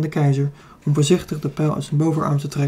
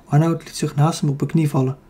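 plain reading voice

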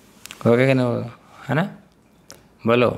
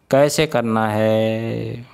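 slow emphasis; much slower than usual. Real, not acted. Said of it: "stressing the point to students".

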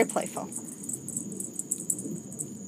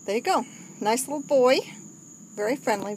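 normal talking voice

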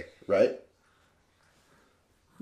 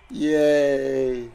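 ordinary talk, nothing marked

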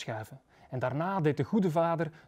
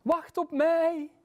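high, drawn-out distant call; stretched high-pitched hail; calling from afar; 11 semitones higher, slower than usual. A narrator's reading. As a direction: call out slowly from far away. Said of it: "riep iemand van heel ver".

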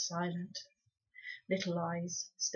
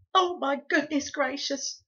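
moaning; 6 semitones higher, 6 dB louder than usual. Acted. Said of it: "moaned Homily".

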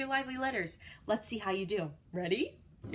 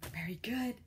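soft breathy "Very good"; whispered praise; 3 semitones lower, 6 dB quieter than usual. Real, not acted. Teacher whispers praise to young students. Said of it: "whisper praise".

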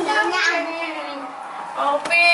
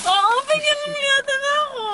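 crying; 13 semitones higher than usual. Real, not acted. Guest crying mid-interview.

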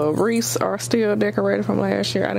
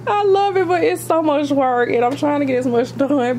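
excited; 6 semitones higher, 4 dB louder than usual. Real, not acted.

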